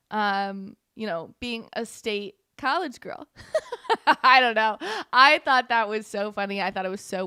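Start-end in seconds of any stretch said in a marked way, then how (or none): 3.3-4.2 high burst of laughter; amused laugh. Real, not acted. Host tickled by her own joke, laughing. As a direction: crack up at your own joke.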